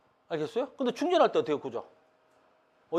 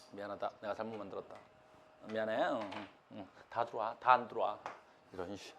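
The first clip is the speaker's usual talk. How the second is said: much lower and softer, under the voice; subdued apology; 9 semitones lower, 13 dB quieter than usual. Real, not acted. Teacher admitting to students the botched problem, subdued apology. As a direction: drop right down to mumble a real apology.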